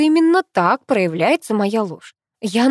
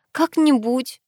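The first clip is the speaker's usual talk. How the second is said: hesitant, raised-pitch evasive reply; mild, unsure mumble; mumbled; 6 semitones higher, slower than usual. A narrator's reading. Narrator evades her sister's question, mumbled. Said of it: "промямлила я".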